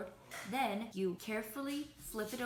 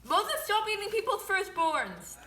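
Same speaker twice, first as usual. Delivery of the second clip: much higher, louder, slow mock yell; 10 semitones higher, 10 dB louder than usual. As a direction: bellow it like a plague curse.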